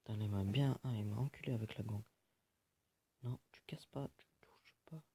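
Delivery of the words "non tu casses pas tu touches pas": hushed whispered warning; whispered telling-off to someone nearby. Real, not acted. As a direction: whisper a scolding so the mic barely hears. Hushed scolding off mic.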